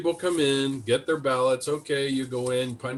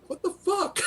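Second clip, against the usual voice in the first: high incredulous what the; stunned disbelief. Retold or imitated. Watching the hearing, high stunned disbelief. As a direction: squeal it in disbelief. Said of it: "I'm going".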